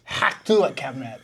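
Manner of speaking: put-on catchphrase in caricatured Russian accent; mock Russian accent. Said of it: "The Russians".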